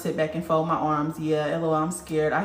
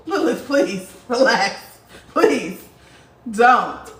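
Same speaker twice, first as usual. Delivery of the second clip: laughing, high, drawn-out please relax; half-laughing plea to calm down; 3 semitones higher, 5 dB louder, much slower than usual. Pretend. To Lilith, a laughing mock plea.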